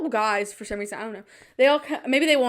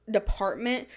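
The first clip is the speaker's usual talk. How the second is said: normal talking voice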